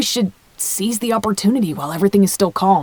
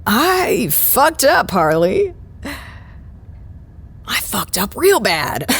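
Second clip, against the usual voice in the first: put-on drunken confession; 2 semitones higher, slower than usual.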